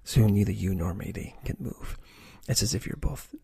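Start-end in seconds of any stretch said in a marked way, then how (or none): none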